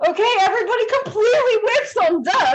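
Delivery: loud, high, rapid exclamation; exasperated outburst. Real, not acted. Game master tells players every attack missed, exasperated outburst. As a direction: burst out fast and high at the misses.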